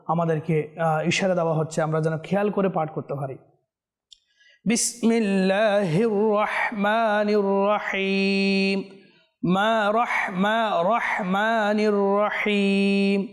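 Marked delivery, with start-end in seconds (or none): 4.6-13.3 slow, drawn-out melodic tajweed chant; Quran recitation. Shown as a demonstration. Teacher reciting a verse for viewers to follow, slow melodic chant. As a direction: chant each syllable long and measured.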